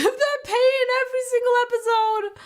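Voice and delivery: high-pitched, hammed-up wail; mock anguished wail. Dramatic wail over her pain.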